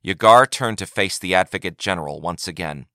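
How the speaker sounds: ordinary delivery, nothing marked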